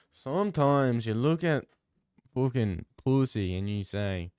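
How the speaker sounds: ordinary talk, nothing marked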